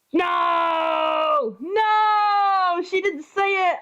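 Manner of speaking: real outraged dismay; dismayed shout. Real, not acted. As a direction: wail it like you were robbed. Disappointed outburst at game.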